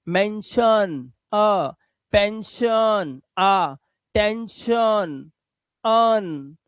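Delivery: each word slowly stretched out; slow demo. Shown as a demonstration. Teacher slowly showing students the आ sound. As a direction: spell out mention, pension, tension very slowly. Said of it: "आ की आवाज".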